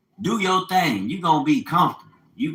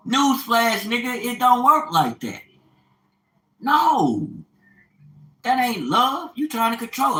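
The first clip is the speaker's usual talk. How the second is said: scolding rant; 8 semitones higher, 4 dB louder than usual. Real, not acted. Scolding listeners about control.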